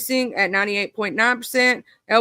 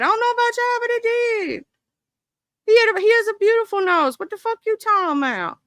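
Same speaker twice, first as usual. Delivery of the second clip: high-pitched outraged squeal, fast; indignant high; 11 semitones higher, faster than usual. Real, not acted. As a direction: jump way up high, rushing in outrage. Indignant defence of Idris.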